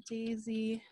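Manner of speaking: normal talking voice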